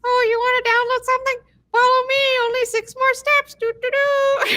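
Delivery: very high, squeaky cartoon helper voice; silly paperclip helper. Paperclip helper offering downloads, very high and squeaky. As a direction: chirp it like a peppy animated paperclip. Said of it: "He's like".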